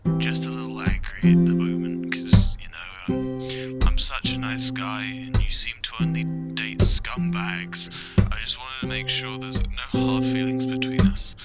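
ordinary talk

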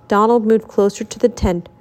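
ordinary delivery, nothing marked

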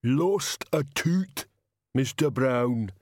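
very slow, lumbering giant voice; big, slow-witted heavy; thunderous Banjo. A narrator's reading. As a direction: rumble it out slowly like a huge simple brute. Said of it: "said the thunder that was Banjo".

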